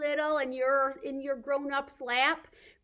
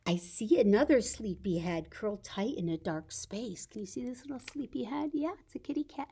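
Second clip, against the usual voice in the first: lively storybook read-aloud, slowing at the ends; bright read-aloud for toddlers; reading to children; 11 dB louder, slower than usual. Acted. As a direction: read the picture book to the little ones. Reads picture book to children.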